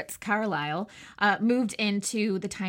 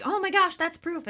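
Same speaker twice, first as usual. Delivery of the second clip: excited; 9 semitones higher than usual. Retold or imitated. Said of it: "they were like".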